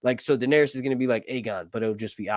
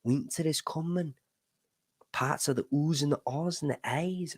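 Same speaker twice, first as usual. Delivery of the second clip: northern English accent; 3 semitones higher, 7 dB quieter than usual. Shown as a demonstration. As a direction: do a quiet northern English lilt. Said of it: "do the northern accent based off".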